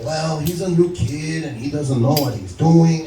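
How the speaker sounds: put-on slow, drawled drunk-sounding manager; drug-dealer voice. Manager excuses the new kid, slow and dealer-like. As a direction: excuse it slowly, like a dazed dealer. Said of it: "making a drug deal at this point with the way the guy's talking".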